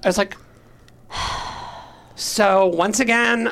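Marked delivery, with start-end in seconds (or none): 1.1-1.7 long, soft exhale; weary sigh. Retold or imitated. Teller recalling being turned down by the psychiatrist, weary sigh. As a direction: let out a long voiceless sigh. Retelling their speechless reaction.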